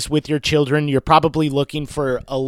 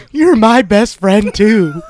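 higher, louder, slow dog's reply; hammed-up talking dog line; 5 semitones higher, 7 dB louder, slower than usual. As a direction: play the dog slowly, pitched up and loud. Voices the dog's reply, cracking up.

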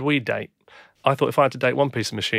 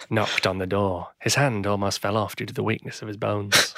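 put-on kids' TV narrator; 4 semitones lower than usual. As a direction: tell it like a children's TV show. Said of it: "sounds like a kid's T V show character".

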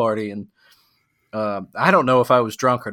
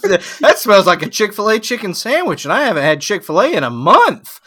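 high, louder mock commentary; out-loud self-talk; 7 semitones higher, 5 dB louder than usual. Home alone rating the smell, announcing loudly. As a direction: declare the verdict to an empty room. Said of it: "rate what it smells like out loud".

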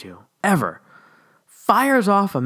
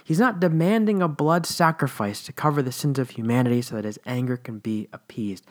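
ordinary talk, nothing marked

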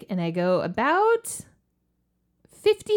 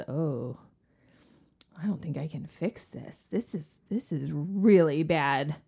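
repairman bad news; 7 semitones lower than usual.

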